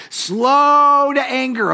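slow to anger, stretched high and long; slow, drawn out. Shown as a demonstration. Preacher savoring slow to anger for the congregation, drawn out. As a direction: let each word of slow to anger linger.

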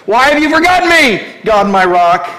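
high loud pleading cry; anguished cry. Acted. Preacher crying the psalm to God, high and loud.

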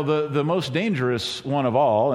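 usual speaking voice

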